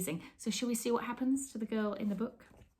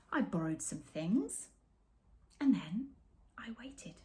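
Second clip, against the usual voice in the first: slow, suspenseful storybook pause; story narration; slower than usual. A narrator's reading. Reading the story to children, hushed suspense. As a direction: hold them in suspense. Reads picture book aloud.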